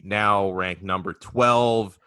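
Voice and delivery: normal talking voice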